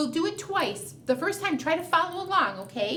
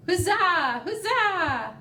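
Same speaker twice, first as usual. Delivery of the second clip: bees cheering; 5 semitones higher, 3 dB louder, much slower than usual. Acted. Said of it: "You're cheering her on".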